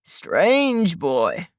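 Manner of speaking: lightly voiced old man murmuring. A narrator's reading. Old man repeats himself.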